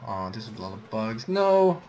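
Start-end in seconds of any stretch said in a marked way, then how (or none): 1.3-1.8 loud, high, startled cry of no; alarmed shout. Real, not acted. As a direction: yell it as the bugs close in. Bugs swarm him in game.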